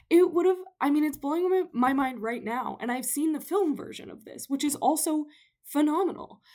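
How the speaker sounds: high and loud, bubbling over; real excitement. Real, not acted. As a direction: gush like a thrilled fan. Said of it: "I'm so excited".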